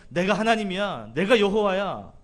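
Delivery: God's bold declaration to Moses; emphatic declaration. Retold or imitated. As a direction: declare it boldly as God. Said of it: "하나님께서 모세에게 하신 말씀이".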